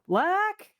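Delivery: high, loud questioning call. Acted. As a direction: call the name up high for help. Calling to Luck for help.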